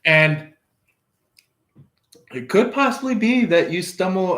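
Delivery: normal talking voice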